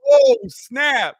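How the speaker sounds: sudden loud high yelp of surprise; excited outburst. Real, not acted. Streamer reacting to a chat message with a loud high excited yelp. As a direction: blurt it out loud and high, caught off guard.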